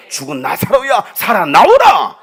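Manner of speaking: loud, slow, drawn-out command; commanding shout. Retold or imitated. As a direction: call the dead man out of the tomb. Jesus calls Lazarus out again.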